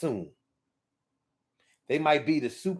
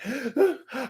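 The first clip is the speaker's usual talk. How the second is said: high gasp of fake shock; 11 semitones higher than usual. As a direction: gasp as if caught out. Guilty boyfriend caught.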